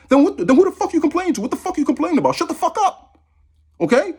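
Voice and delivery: fed up, let it fly up high; exasperated outburst. Real, not acted. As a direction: pitch jumps high in a real exasperated outburst. Exasperated rant at critics.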